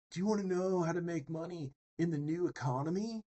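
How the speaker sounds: mock hustler pitching a secret; twenty-five-year-old YouTube pitchman. Imitating a make-money ad, mock pitchman voice. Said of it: "I don't know why I'm talking like this".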